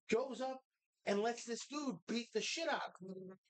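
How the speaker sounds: normal talking voice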